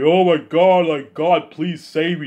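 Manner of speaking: loud, high pretend wailing plea; mock crying like a baby. Man begging God to save him, mock tearful wail. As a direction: cry out in hammed-up desperation. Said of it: "gonna cry like a baby".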